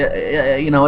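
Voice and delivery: ordinary talk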